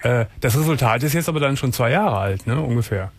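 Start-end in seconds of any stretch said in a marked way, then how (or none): none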